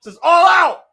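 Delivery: fed-up fan shouting at the screen; angry rant. Real, not acted. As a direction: yell your frustration at the promotion. Ranting at the show.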